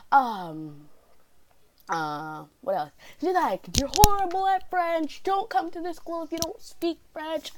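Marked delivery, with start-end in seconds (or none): 3.8-7.5 high-pitched, hammed-up scolding; mock berating teacher. Teacher berating student in French school, mock scolding.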